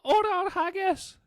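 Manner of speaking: put-on very high, slow and loud child's battle cry; little priest boy proves he is Scottish, high, slow, crying out; little boy's Braveheart line. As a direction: squeak the freedom speech like a tiny boy, shouting. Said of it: "You know the lines. Say".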